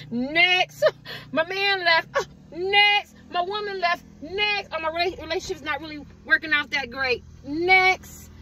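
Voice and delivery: high, breezy "next" with a laugh; unbothered. Retold or imitated. Someone unbothered by life's blows, laughing "next". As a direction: brush every loss off lightly with a laugh. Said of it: "You're like".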